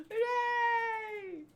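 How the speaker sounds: high, soft drawn-out cheer; a small far-away hooray, held long; quiet high-pitched whoop. Acted. Reads crew cheering, softer.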